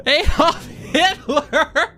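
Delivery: high, slow laugh breaking through; laughing in disbelief. Real, not acted. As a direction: crack up at the absurdity. Disbelief at Hitler credits.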